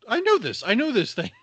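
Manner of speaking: excited recognition. Retold or imitated. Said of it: "I was just like".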